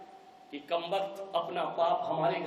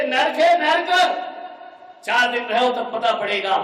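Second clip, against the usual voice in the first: angry rant; 5 semitones higher, 9 dB louder than usual. Acted. Daughter calls in-laws hell.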